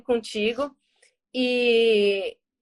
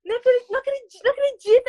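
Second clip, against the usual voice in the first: high, overwhelmed reenacted disbelief; high-pitched, emotional retelling of shock; tearful disbelief; 8 semitones higher than usual. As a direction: act out being about to burst into tears. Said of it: "Eu comecei a chorar".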